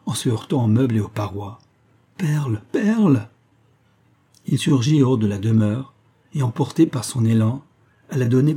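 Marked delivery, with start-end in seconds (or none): 2.2-3.3 higher, slow anguished cry of her name; lightly voiced terrified wail; horrified howl. A narrator's reading. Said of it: "il poussa un véritable hurlement".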